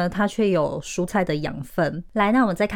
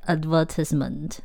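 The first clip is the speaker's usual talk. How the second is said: British "advertisement", stressed on the second syllable; British pronunciation; 3 semitones lower, 3 dB quieter than usual. Shown as a demonstration. Teacher contrasting with the American recording, British accent. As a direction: say "advertisement" as ad-VER-tis-ment, British style. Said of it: "英国人说的是advertisement".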